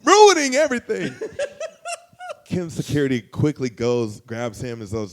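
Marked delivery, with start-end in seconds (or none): 0.0-1.1 much higher and louder, laughing; hammed-up panicked outburst; mock exasperated yell. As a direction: yell in disbelief at the friend. Retold panic at friend.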